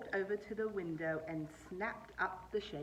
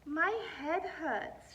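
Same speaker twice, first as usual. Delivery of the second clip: slow, higher drawn-out moan; 7 semitones higher, 4 dB louder, much slower than usual. Acted. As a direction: drag it out, pitiful. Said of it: "she moaned".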